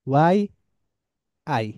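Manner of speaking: ordinary delivery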